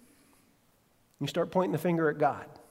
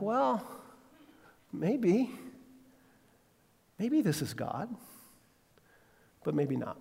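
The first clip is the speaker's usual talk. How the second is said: soft, hesitant, gently teasing; gentle doubt; 3 semitones higher, 9 dB quieter than usual. Retold or imitated. Pastor answering the traveler, soft and dryly hesitant. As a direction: let the hint land softly. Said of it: "And I'd be like".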